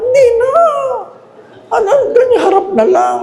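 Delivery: exaggerated comic protest; high comic exclaim. Real, not acted. Scene: priest jokes about coffin glass to congregation, high and fast. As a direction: shoot way up and rush it. Mock exasperated joke.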